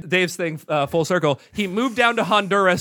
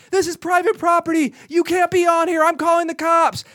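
crazy woman; 10 semitones higher than usual. Retold or imitated. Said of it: "she's like".

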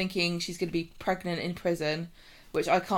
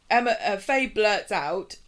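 ordinary talk

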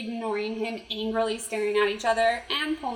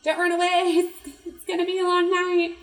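higher and louder, calling out through the building; yelling after him; 7 semitones higher, 7 dB louder than usual. Retold or imitated. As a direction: shout for the runaway man, half laughing. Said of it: "them yelling".

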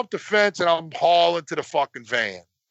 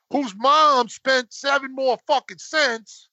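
usual speaking voice

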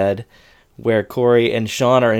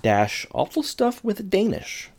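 title read much higher and slower, softer; mock title; 9 semitones higher, 5 dB quieter, slower than usual. Shown as a demonstration. Naming the segment Awful Stuff with Danish, mock high voice. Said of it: "repeats show segment title".

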